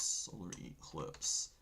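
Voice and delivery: slow whisper while typing; whispered to himself. Real, not acted. Host typing a search, whispered to himself. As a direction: breathe the words as you type them.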